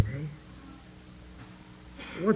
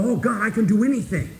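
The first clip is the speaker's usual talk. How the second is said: mock overconfident; 6 semitones higher, 4 dB louder than usual. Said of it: "we go".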